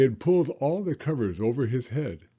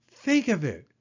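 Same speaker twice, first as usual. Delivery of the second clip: cheerful frog; 7 semitones higher than usual. Acted. Frog's voice.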